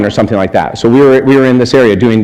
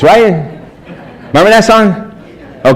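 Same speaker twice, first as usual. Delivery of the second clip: ordinary talk, nothing marked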